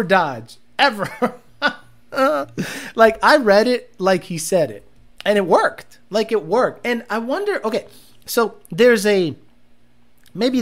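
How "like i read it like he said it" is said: ordinary talk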